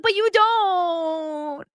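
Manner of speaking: high, drawn-out mock wail; dramatic Annie. Annie insisting to Nick she loves him, slow high wail. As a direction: stretch every word into a slow, high lament. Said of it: "And she's like".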